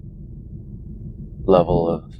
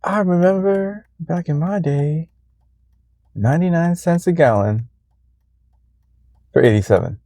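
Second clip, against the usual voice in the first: put-on old man voice; 9 semitones higher than usual. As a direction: reminisce like a grandpa. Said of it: "Sound like an old man in my head".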